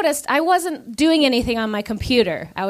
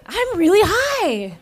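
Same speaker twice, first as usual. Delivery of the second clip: high, slow dawning panic; stoned realization; 9 semitones higher, much slower than usual. Retold or imitated. At the desk realizing, high slow panic. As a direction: realize you're high, half laughing. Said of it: "I was like".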